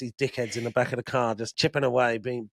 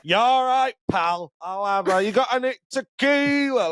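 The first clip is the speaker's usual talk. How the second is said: higher, louder mock drunk bar order; drunk Mikey; 9 semitones higher, 3 dB louder than usual. Retelling a drunk friend ordering tequila, high loud mock drunk. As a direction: ham up a tipsy mate calling to the barman. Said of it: "'cause he's smashed".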